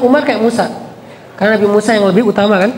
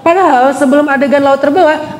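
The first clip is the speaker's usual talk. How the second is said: usual speaking voice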